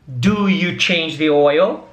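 slow, spaced out question with a rise; slow model question. Shown as a demonstration. Teacher models a question for students, slow and clear. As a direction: ask it slowly for the learners. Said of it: "pra fazer uma pergunta".

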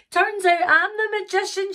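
high, delighted voice; gleeful Sarah. Acted. Sarah realising she is the magician, gleeful. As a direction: announce it high and triumphant. Sarah triumphant after laughing.

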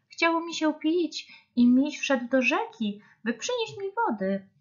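high-pitched small animal voice; earnest little hare. A narrator's reading. Hare explaining to the mothers, earnest and high. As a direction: explain in a high, childlike story voice.

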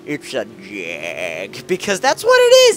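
Top Gear presenter voice. Retold or imitated. Gamer slowly intoning a car-show verdict on the Jag. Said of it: "trying to imitate Top Gear voices".

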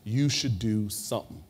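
slow, deliberate punchline; lightly voiced stern woman handing down her verdict; firm pronouncement. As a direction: deliver it slowly, spacing the words for effect. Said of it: "she crosses her arm and says".

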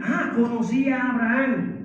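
ordinary talk

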